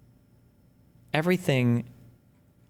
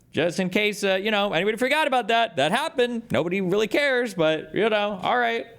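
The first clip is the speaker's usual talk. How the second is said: sarcastic high voice; 11 semitones higher than usual. Acted. Sarcastic high aside continues.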